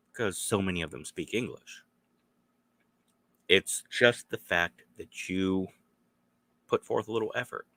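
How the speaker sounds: normal talking voice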